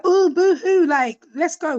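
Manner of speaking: high, loud teasing scoff; mocking boo-hoo. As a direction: brush off the self-pity, loud and high. Daughter mocks mum's self-pity.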